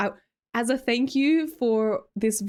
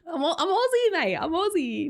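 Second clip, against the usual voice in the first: laughing protest; 7 semitones higher than usual. Real, not acted.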